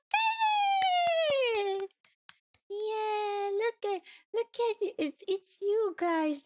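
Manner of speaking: put-on high, slow cooing baby talk; cutesy baby voice. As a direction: coo at the little dog. Baby talk to dog doodle.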